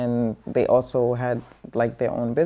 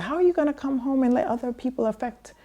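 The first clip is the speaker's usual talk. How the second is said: high, fast exasperated lecture; mother scolding her child, high fast exasperated voice; mom lecturing; 9 semitones higher, 3 dB quieter, faster than usual. Retold or imitated. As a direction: rattle off a mother's lecture, pitched up. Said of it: "she said".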